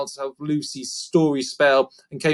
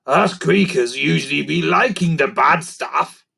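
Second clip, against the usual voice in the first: louder, gleeful creature voice; creaker voice; 5 dB louder than usual. A narrator's reading. Gruff telling Lucy what creakers like, in a loud creaker voice. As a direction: relish the bad stuff like a goblin.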